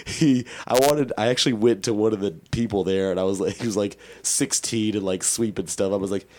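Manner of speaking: normal talking voice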